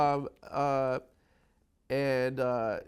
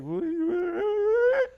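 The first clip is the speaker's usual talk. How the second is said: slow, high, loud weepy wail; sobbing; 8 dB louder, much slower than usual. Retold or imitated. Retelling his weeping proposal to the girlfriend, sobbing. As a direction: cry the question out, drawn out and broken. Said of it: "I was like".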